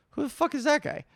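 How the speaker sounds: high, incredulous mock question; baffled teammates. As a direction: imitate bewildered teammates eyeing a stranger. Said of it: "And they're like".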